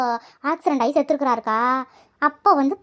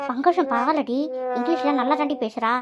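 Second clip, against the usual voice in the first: usual speaking voice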